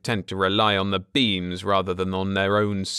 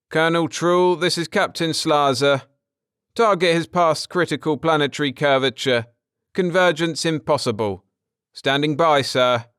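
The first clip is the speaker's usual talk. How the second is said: formal military report; 6 semitones higher, 4 dB louder than usual. Acted. Captain reports to his colonel.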